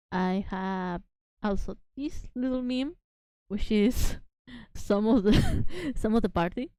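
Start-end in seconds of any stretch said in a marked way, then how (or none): none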